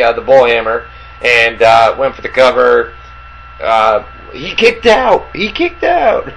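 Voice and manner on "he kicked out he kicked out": drawn-out high imitation of a little kid; Jacob. Podcaster mimicking little Jacob over a false finish, high drawn-out imitation. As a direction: mimic a two-year-old's slow, rising-pitched exclamation. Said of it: "Like Jacob".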